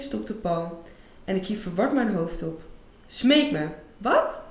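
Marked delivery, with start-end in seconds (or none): 3.1-3.7 commanding. Acted. Paul commands her to beg. 4.0-4.4 a sharp high startled "what?"; surprised. Acted. Isa startled when Paul suddenly demands she beg, surprised.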